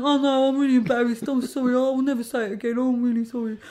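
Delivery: a hint of a mortified man saying sorry; grovelling heckler. Heckler apologises to the comedian, mock grovelling.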